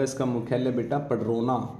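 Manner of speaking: usual speaking voice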